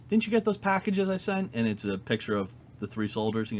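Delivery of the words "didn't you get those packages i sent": high-pitched, lightly acted feigned surprise; mock-innocent reminder. Woman leaning on a retired investigator, mock-innocent. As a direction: voice her pointed question up high, as if innocent. Retelling her veiled threat to retiree.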